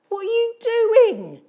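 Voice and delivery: very high, loud shriek; shouted. Acted. Edith shrieking at Eric, a very high loud shout. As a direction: screech it at her son. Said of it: "she shouted".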